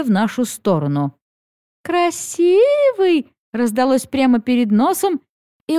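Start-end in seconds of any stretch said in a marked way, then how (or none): 1.8-3.3 high, slow, teasing coo; drawn-out mermaid coo. Acted. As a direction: coo it slowly, flirting. Mermaid coos at Dar.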